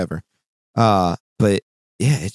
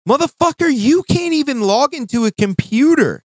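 much higher and louder, an incredulous angry yell; angry outburst; 5 dB louder than usual. Retold or imitated. Telling off the coworker who can't log in, an angry yell. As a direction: blast the lazy coworker in disbelief.

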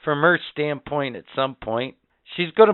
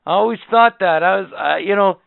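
heated rant; 7 semitones higher, 6 dB louder than usual. Real, not acted. Ranting about delivery room.

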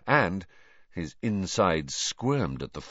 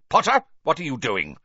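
sharp loud demand; 7 semitones higher, 7 dB louder than usual. Acted. Teacher catches student.

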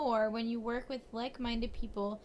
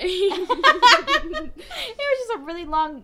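laughing; 11 semitones higher, 12 dB louder than usual. Real, not acted. Laughing at her own reading.

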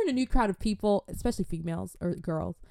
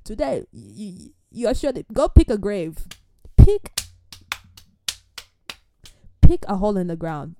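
mock death threat; 7 dB louder than usual. Telling the rude girl to pick a grave, hammed-up menace. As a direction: threaten her like a villain.

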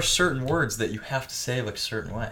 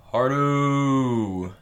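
HARDO drawn out very slowly, higher and louder; elongated; 4 semitones higher, 6 dB louder than usual. Shown as a demonstration. Friend copying the other's insult, HARDO elongated. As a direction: hold the final O of HARDO way too long. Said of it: "You gotta elongate it".

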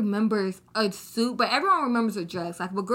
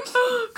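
high, hammed-up gasp; mock gasp; 14 semitones higher than usual. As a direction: fake a dramatic gasp. Mocking a dramatic overreaction.

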